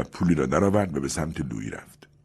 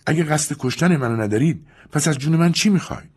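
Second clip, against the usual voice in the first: higher, imperious woman; commanding tone; 11 semitones higher, 4 dB louder than usual. Acted. Julia to Louis, imperious and demanding. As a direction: demand an answer from above. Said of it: "با لحن تحکم آمیزی گفت".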